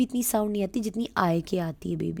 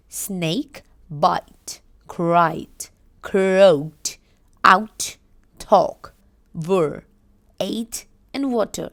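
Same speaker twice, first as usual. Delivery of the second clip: reading list; 4 dB louder, much slower than usual. Shown as a demonstration. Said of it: "अब इसने बोला special words".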